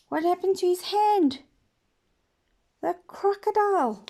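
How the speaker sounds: high, loud quiz questions; high lively questions and answer; excited storytelling. Acted. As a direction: quiz the kids eagerly. Animated questions to children.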